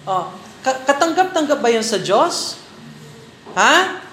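ordinary talk

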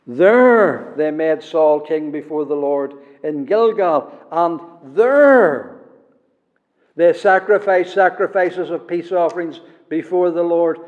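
each "there" opens the phrase, stressed and falling. Shown as a demonstration. Preacher reads the verse, leaning hard on each "there". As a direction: lean on every "there" as you read the verse. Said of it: "how the Holy Spirit is emphasizing it".

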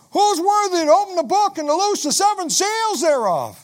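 high, loud heavenly cry held to the end; angel crying out. Acted. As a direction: cry the whole question over heaven. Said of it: "somebody cries out".

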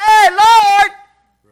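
loud, high holler to heaven; calling out. Acted. Preacher acting out someone asking God for help, loud call.

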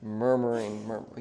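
low, soft murmur of one word. Shown as a demonstration. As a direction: drop the pitch and murmur it softly. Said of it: "say it in a low tone".

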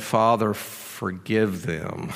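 soft, slow, low-pitched plea; quiet prayer. Retold or imitated. Jesus praying to his Father for his mockers, hushed and slow. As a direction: let the words sink down softly and slowly.

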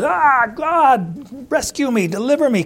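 high-pitched groaning plea to God; acted crying out. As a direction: cry out for rescue, as if imitating a desperate Job. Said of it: "crying out, groaning, roaring".

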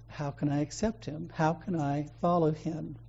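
very soft, half-choked questions; a lightly voiced weeping convert; quiet tearful plea. Persian man asking the pastor, softly and near tears. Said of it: "And the man starts crying".

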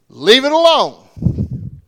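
loud, slow commanding voice; stern command. Acted. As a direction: lay down the law slowly and loudly. God's command in the garden.

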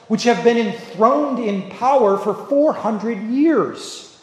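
full sermon delivery from the pulpit, rising and falling; preaching. Acted. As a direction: preach it to the congregation with conviction. Preaching a sermon on Psalm 118.

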